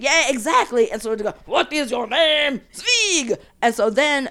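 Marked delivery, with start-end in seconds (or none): 1.5-2.6 louder, slow mock French; French accent. Census man asking the German, mock French accent. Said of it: "Is this your French accent". 2.7-3.4 higher, emphatic mock German; German accent. German immigrant repeating his surname, hammed-up German accent. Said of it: "the German guy was like".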